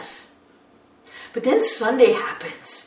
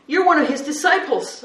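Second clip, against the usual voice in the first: louder, higher pointed claim; accusing; 6 semitones higher, 6 dB louder than usual. Acted. Bystander to Peter in the courtyard, loud accusation. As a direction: nail him with it.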